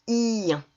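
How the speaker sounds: "Ien" with a long i, ending in nasal un; drawn-out I. Shown as a demonstration. Teacher modelling the E N rule, i held long. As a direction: hold the i in "Ien", then glide to the nasal. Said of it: "transforme le E N en son un".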